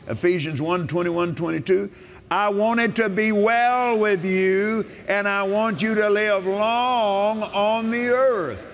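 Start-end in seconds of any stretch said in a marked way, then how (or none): 2.3-8.6 slow, higher, ringing proclamation of the verse; solemn preacher's proclamation; preaching scripture. Acted. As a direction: declare it as the voice of God himself, slowly.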